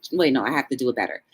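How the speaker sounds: ordinary talk, nothing marked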